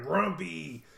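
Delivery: a short sulky grunt of a word; mildly growled one-word brush-off; grumpy grunt. Acted. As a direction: grumble it like a husband who wants no talk. Brutus grunting at his wife.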